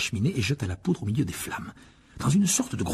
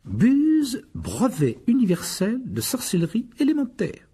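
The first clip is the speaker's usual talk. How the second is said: higher, slow explanation; explaining George; 11 semitones higher, slower than usual. Acted. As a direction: spell out the acronym. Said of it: "expliqua George". (George explique lentement)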